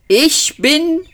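slow, high grand proclamation; announcing. A narrator's reading. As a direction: proclaim your name pompously. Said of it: "verkündete der Ältere". (langsame, hohe, feierliche Verkündung)

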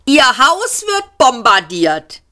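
high, slow, emphatic yell; bellow. A narrator's reading. Seyfort warning the man of the bombing, bellowing. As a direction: shout each word right into the ear. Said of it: "brüllte ihm Seyfort ins Ohr".

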